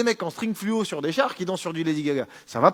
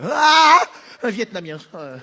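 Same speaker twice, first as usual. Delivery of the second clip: mock fright; 3 semitones higher, 7 dB louder than usual. Xenophobe scared in street.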